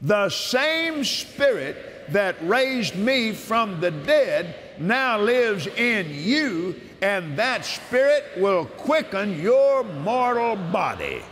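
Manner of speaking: solemn preacher's proclamation; preaching scripture. Acted. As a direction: declare it as the voice of God himself, slowly.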